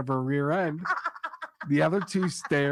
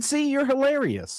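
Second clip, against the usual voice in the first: sarcastic wife; 13 semitones higher, 4 dB louder than usual. Acted. Wife's sarcastic punchline.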